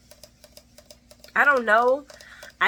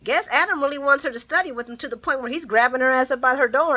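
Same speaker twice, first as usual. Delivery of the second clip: usual speaking voice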